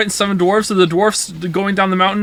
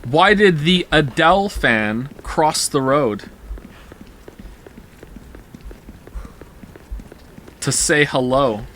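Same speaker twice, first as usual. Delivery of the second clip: slow joke delivery; 2 semitones lower, slower than usual. Shown as a demonstration. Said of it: "here we go".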